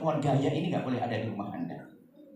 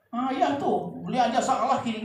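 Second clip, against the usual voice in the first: mock blaming husband; 9 semitones higher, 4 dB louder than usual. Suami menyalahkan.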